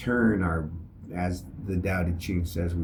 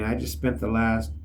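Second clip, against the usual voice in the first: ordinary talk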